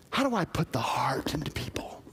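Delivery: soft unvoiced whisper. Pastor voices a listener's inner question, hushed whisper. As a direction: whisper it like a private thought to yourself.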